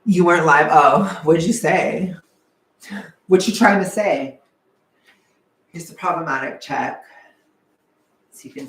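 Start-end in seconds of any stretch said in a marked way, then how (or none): 0.1-4.4 mock outrage. Mock confrontation with chat.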